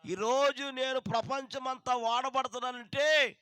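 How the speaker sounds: loud, driving sermon delivery; preacher rousing the congregation to answer; full-force preaching. Acted. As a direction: preach it out to the whole hall.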